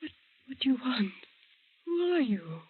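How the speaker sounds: high, startled questions; frightened. Acted. Woman confronts figure in her room, frightened. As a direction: gasp it out in fright.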